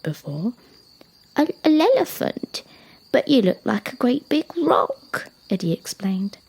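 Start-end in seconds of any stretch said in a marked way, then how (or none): 1.4-5.3 put-on high, halting child giraffe question; puzzled young giraffe. Young giraffe doubting the elephant, high and surprised. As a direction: stumble into a high, surprised query.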